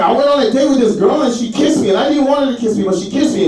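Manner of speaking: high, whining retelling; whiny complaint. Retold or imitated. To his roommate about the date, high whiny complaint. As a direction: complain like a wronged kid. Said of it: "I was like".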